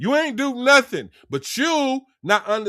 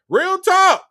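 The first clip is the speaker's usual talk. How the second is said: much higher and louder "Real talk"; hyped shout; 10 semitones higher, 7 dB louder than usual. Real, not acted. Streamer hyping chat comments, loud hyped shout. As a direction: hype up the chat.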